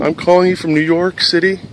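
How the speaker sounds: high, loud upset caller; lightly acted distress, voice pushed up; retold tearful caller; imitated crying caller. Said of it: "she's crying very hard".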